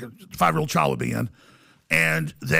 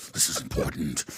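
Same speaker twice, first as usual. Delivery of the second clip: low breathy whisper; hushed reproof; 8 dB quieter than usual. Retold or imitated. Storyteller mimics old men answering Mike during the ritual, hushed whisper. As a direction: whisper it as an imitation of rapt watchers. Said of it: "They're like".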